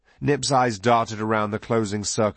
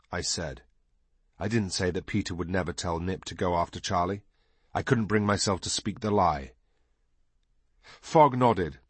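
plain reading voice

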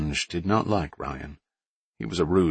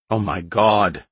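put-on loud, high Boston accent gasp; thick Boston accent, amazed; 4 semitones higher, 4 dB louder, slower than usual. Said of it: "she said in a thick Boston accent".

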